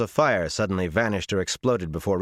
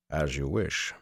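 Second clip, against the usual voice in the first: lower, softer, dry acceptance; wry; 4 semitones lower, 5 dB quieter, slower than usual. A narrator's reading. Hawk-nosed man answers a refused healing, wryly. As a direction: give way quietly with a touch of irony. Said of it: "the hawk-nosed man said wryly".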